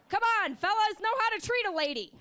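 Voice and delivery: high, loud, mock offended lady; prissy lady voice. Voicing a hot dog demanding respect, high mock lady. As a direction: scold them like a dainty lady.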